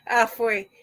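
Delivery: normal talking voice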